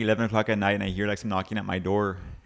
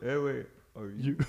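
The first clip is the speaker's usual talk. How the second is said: slow, softer catchphrase imitation; all slow; 7 dB quieter than usual. Teammate answering when asked once, slow and soft. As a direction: draw the catchphrase out slowly and quietly. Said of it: "all slow".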